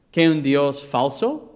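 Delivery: usual speaking voice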